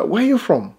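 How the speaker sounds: lightly acted, high-pitched question; lightly imitated small-talk question; curious stranger.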